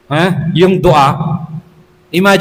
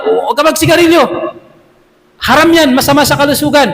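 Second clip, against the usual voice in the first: higher, stern warning father; 6 semitones higher than usual. Retold or imitated. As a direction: forbid the son firmly. Father forbidding smoking. (tatay na mahigpit na nagbabawal)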